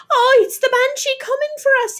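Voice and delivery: very high, loud frightened scream; terrified scream. A narrator's reading. Girl woken by pounding, screaming in terror. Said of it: "Ailish screamed".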